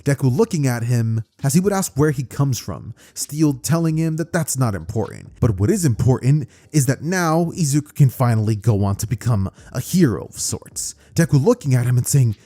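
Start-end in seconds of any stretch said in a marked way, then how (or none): none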